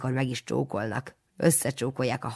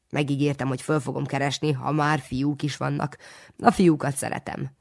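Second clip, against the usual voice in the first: usual speaking voice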